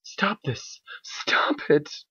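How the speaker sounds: high frantic plea, half a sob; high panicked cry to stop; desperate scream. Acted. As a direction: beg them to stop, terrified. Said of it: "Twilight screamed, now crying".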